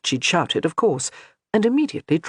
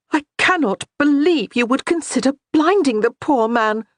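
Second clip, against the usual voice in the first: cried out; 9 semitones higher, 3 dB louder than usual. A narrator's reading. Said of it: "she cried out".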